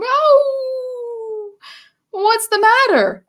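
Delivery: high, caring question; concerned giant. A narrator's reading. George kindly asks the howling dog what is wrong. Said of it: "asked George".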